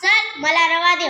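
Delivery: hammed-up angry shout at the grocer. A narrator's reading. She-fox scolds the grocer, mild mock anger. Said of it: "रागात म्हणाले".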